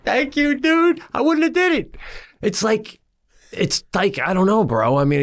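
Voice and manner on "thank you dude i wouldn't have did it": grateful relief. Retold or imitated. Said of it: "I'm like".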